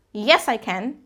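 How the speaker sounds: finished answer falling away on CAN; falling intonation. Shown as a demonstration. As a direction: bring the pitch down at CAN. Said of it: "falling intonation when you're finishing".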